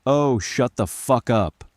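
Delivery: an understated angry scream, stretched out. A narrator's reading. Linda cuts off her husband, screaming in mild earnest. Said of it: "she screamed".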